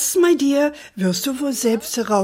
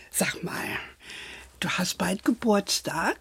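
soft secretive whisper; 2 semitones lower, 7 dB quieter than usual. Acted. Grandmother whispering to granddaughter, secretive. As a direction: let her in on a secret.

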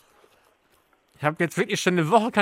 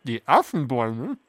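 mouth full. Real, not acted. Host corrects with full mouth.

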